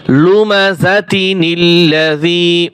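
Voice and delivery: slow chant of "লুমাযাতিনিল্লাযী" in one run; joined recitation. Shown as a demonstration. Teacher repeats how to join, slowly chanting "লুমাযাতিনিল্লাযী". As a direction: link "লুমাযাতিনিল্লাযী" through t and n, vowels held. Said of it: "এভাবে পড়তে হবে".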